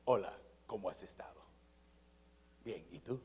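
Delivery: very low, soft announcer delivery; movie-trailer voice. Acted. Suitor courting his future wife, movie-trailer voice. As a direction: sink into deep trailer narration. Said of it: "de anuncio de película".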